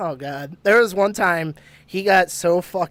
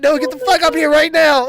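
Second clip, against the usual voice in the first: higher, louder urgent order; imitating friend's screaming; 7 semitones higher, 6 dB louder than usual. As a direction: re-enact a friend yelling for you to come up. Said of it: "he started screaming for me to come up".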